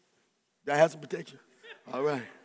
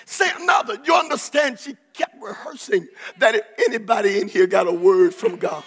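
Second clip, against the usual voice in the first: ordinary delivery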